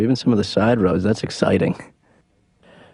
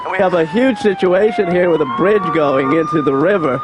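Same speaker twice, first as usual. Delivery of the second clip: urgent on-the-scene reporter read; live traffic report; 6 semitones higher than usual. Pretend.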